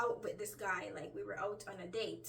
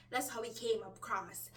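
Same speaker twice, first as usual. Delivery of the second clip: normal talking voice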